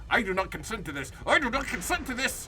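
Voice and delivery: rising into a frightened yell; real fear, voice climbing into a shout; panicked protest. Real, not acted. Panicked at jumpscare in game.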